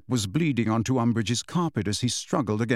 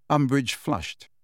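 ordinary delivery, nothing marked

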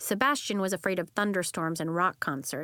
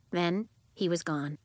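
ordinary delivery, nothing marked

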